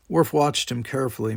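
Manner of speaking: ordinary delivery, nothing marked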